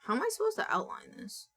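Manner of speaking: baffled by the tool; exasperated squeak. Real, not acted. Streamer stuck on the drawing tool, high exasperated question.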